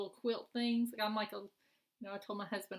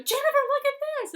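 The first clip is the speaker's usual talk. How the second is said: excited squeal; 12 dB louder, faster than usual. Retold or imitated. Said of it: "she'd go".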